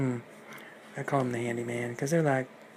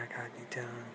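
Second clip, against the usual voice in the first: breathy whispered reply, no voice; 12 dB quieter, much faster than usual. Acted. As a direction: whisper it like a secret. Wolf as Grandma answers.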